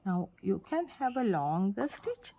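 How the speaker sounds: ordinary delivery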